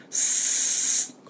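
long voiceless hiss like air leaking from a tyre; a thin hiss of escaping air; hissing s sound. Shown as a demonstration. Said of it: "これSですね".

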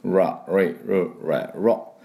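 ラ レイ ル レ ロ with the tongue pulled back, from the throat; English R sounds. Shown as a demonstration. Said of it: "喉の方から声を出します".

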